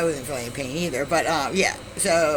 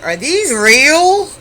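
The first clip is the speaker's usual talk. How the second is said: put-on very slow, higher and louder, amazed drunk; drunken wonder; 9 semitones higher, 9 dB louder, much slower than usual. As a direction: stretch every word like a wide-eyed drunk. Drunk boyfriend pokes dessert replicas.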